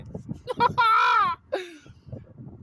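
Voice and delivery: big high cackling laugh; loud laugh. Real, not acted. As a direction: crack up at your own story. Cracks up at the punchline.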